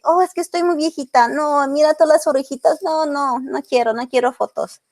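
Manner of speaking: high, whiny mock refusal in Spanish; imitating a reluctant client. Photographer imitating clients who refuse photos, mock whiny. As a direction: play a shy mother waving off the camera. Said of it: "a lot of women that come to my studio like".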